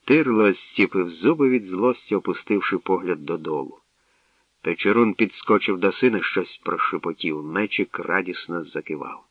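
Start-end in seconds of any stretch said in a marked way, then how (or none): none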